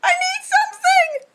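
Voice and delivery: high, loud, frantic call; urgent squeal. Real, not acted. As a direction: scramble for something to cover it. Urgent reaction to the wound.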